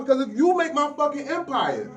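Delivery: usual speaking voice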